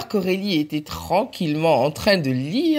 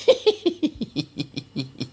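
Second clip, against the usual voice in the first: quiet mocking giggle; soft giggle; 4 semitones lower, 4 dB quieter than usual. Friends laughing at Mathieu, soft giggle. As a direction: giggle under your breath. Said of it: "elles ont ri".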